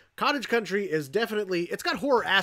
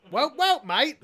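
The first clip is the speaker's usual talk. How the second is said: mock Australian; 9 semitones higher, 4 dB louder, slower than usual. Jokey Australian impression.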